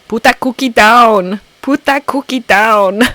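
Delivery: shouted action-star impression; Arnold Schwarzenegger. Quoting the action star for viewers, shouted impression. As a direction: yell it in a thick Austrian-style accent. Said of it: "for all the Arnold quotes".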